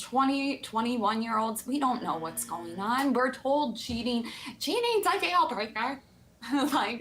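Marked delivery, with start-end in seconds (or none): 4.6-6.0 high, lightly put-on parroting voice; mock repeated warning. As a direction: parrot the rule in a higher voice. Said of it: "You gotta say it like that".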